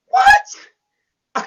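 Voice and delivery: a shocked What leaping very high; stunned what. Retold or imitated. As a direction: squeal it out in total disbelief. Shocked at the news in car.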